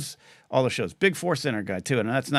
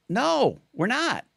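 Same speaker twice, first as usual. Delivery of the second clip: emphatic denial; 6 semitones higher, much slower than usual. Real, not acted. Retorting to critics, pitch jumps.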